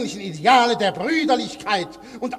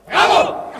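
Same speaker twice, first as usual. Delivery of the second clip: shouted bravo; 9 semitones higher, 6 dB louder than usual. Acted. Heckler cheers the speech.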